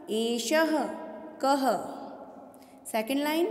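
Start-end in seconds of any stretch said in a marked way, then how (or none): 0.1-1.7 "एषः कः" word by word, each ending in ha; visarga sounded. Shown as a demonstration. Teacher repeats so students hear the two dots, visarga sounded. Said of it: "सुनिए वापस".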